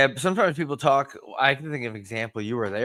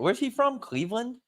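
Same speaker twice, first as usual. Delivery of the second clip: ordinary talk, nothing marked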